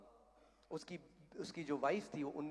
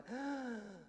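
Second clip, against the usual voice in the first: high, drawn-in machine breath; imitated gasp; 7 semitones higher, 3 dB quieter than usual. Preacher showing the boy breathing on the ventilator, imitated gasp. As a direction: suck in one long breath. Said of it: "जैसे वो एक सांस लेता".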